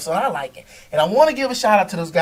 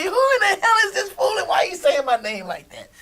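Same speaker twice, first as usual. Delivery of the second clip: laughing; 11 semitones higher, faster than usual. Retold or imitated. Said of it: "He probably say".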